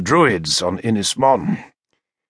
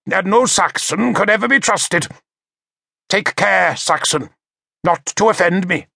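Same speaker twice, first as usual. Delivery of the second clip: higher, scornful threat; menacing warning; 8 semitones higher than usual. A narrator's reading. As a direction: warn him with open contempt. Bishop threatens the narrator.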